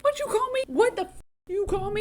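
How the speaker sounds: very high, outraged squeal; affronted at being called a name; indignant Vernon. Retold or imitated. Vernon hearing himself called a muggle, high and outraged. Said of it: "Vernon is like".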